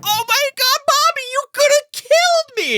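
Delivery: very high, loud, hammed-up outrage; mock hysterical mother. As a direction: overact a shrieking, nearly killed mom. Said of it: "The way that she says that".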